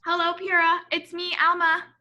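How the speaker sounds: put-on high, loud little girl greeting; a small girl calling to her great aunt; child voice. Alma greets her great aunt Pura, lightly sweet girl voice.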